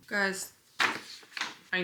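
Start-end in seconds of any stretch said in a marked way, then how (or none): none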